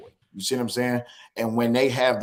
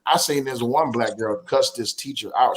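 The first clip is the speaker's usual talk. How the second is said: ordinary talk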